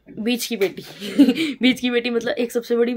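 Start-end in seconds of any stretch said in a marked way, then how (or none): 0.9-1.6 short, louder giggle. Real, not acted. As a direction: burst into a brief giggle. Giggles at her own wording. (छोटी-सी खिलखिलाहट)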